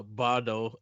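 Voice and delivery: usual speaking voice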